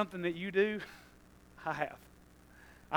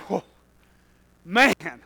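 ordinary talk, nothing marked

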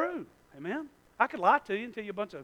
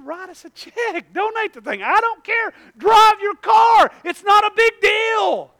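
from calm to loud, slow dismissal; building shout; 12 semitones higher, 10 dB louder, slower than usual. Retold or imitated. Friend shouting that it is no big deal. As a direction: wave it off, louder and louder.

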